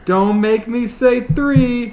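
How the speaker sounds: high, slow stern warning. Acted. Panty counting down at Chuck, stern warning. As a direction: drag out the threat. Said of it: "said Panty".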